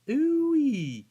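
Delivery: loud, drawn-out high "ooh-wee"; high whoop. Acted. Whooping after mock player quote.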